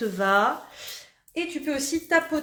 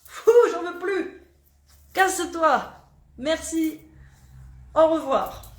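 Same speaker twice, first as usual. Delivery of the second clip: loud, higher, playful brush-off; dismissive send-off; 5 semitones higher, 5 dB louder, slower than usual. Acted. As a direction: shoo it away out loud with mock firmness. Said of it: "Tu dis, tiens". (congédiement théâtral et enjoué)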